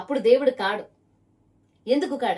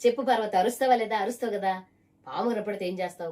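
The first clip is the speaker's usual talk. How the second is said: ordinary talk, nothing marked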